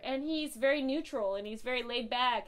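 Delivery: usual speaking voice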